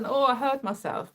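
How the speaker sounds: ordinary talk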